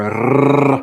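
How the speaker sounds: long rolled "rrr"; tongue-trilled rrr; trilled growl. Real, not acted. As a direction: roll the R into a drawn-out noise. Rolled noise after naming rapper.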